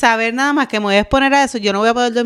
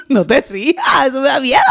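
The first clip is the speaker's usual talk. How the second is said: laughing protest; 5 semitones higher than usual. Real, not acted. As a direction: beg them not to laugh while giggling. Friend laughs at her fear.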